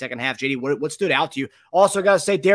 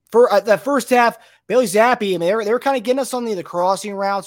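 louder and higher hyping the defense; excited; 6 semitones higher, 7 dB louder than usual. Real, not acted. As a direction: get fired up about the first half.

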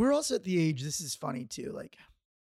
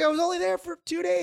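mock defensive; 11 semitones higher, 3 dB louder than usual. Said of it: "He's like".

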